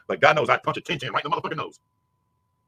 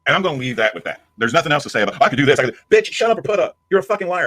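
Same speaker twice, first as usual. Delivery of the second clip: louder, rising to a furious yell; loud angry outburst; 8 dB louder than usual. Real, not acted. Streamer shouting down rival's boast, furious yelling. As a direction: blast back at her bragging.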